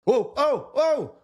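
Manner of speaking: mock wound-up whoa; revved-up whoa. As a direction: react like a tense movie scene. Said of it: "I'm sitting there like".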